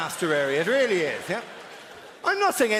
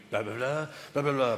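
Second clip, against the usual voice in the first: lowered barrister patter on blahs; right way demonstrated; 5 semitones lower, 3 dB quieter than usual. Shown as a demonstration. Barrister showing how to address a jury, lowered patter. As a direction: run the blahs like a courtroom speech, pitched low. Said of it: "This is the way you do it".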